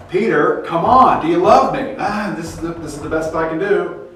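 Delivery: a voiced Jesus coaxing Peter; pleading Jesus. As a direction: press him, half exasperated.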